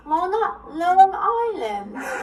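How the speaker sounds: "Long Island" wailed high and slow in a mock sob; fake-cry the words "Long Island"; hammed-up sobbing over "Long Island". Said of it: "you're making me double guess myself".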